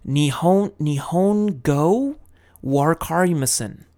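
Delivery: slow, hesitant "Nihongo wakarimasen" with a heavy American R; thick American accent. Shown as a demonstration. Tutor shows dodging the NHK collector, slow thick American accent. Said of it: "thicken up the accent".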